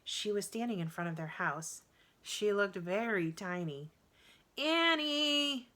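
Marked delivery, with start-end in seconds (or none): 4.6-5.7 high, loud call from far away; calling from afar. A narrator's reading. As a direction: shout their names across the yard. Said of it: "she called".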